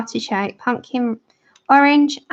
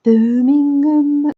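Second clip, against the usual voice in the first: put-on slow, drawn-out, butchered Birmingham accent; 4 semitones higher, 4 dB louder, much slower than usual. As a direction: stretch it out in a bad Brummie accent. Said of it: "I'm really butchering them".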